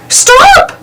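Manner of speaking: high sharp cry of stop; Mickey crying out to Goofy. A narrator's reading. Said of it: "Mickey cried".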